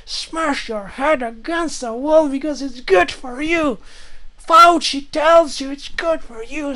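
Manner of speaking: high, slow, frantic mock rant. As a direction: shriek orders like a deranged zealot. Mock ranting Fauci-style command.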